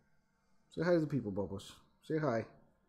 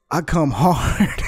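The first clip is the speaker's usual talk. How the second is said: slow, drawn-out put-on voice; mock dubbed line; 2 semitones higher, 5 dB louder, much slower than usual. Mocking the interview clip, a slow put-on voice. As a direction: drag it out like a cheesy overdub. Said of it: "Cut to".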